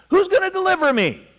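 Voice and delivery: high, sustained cry for rescue; pleading cry. Acted. Preacher voicing Paul stuck in sin, high pleading cry. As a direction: call out high, dropping at the end.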